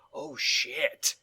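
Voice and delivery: low, soft and quick, caught off guard; lightly voiced stunned viewer; hushed surprise. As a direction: mutter it like a twist just hit you. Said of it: "I was like".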